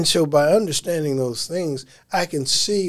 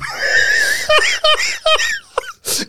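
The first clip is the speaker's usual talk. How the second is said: shrieking laugh. Real, not acted. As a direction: let out a cackling shriek. Cracking up mid-story.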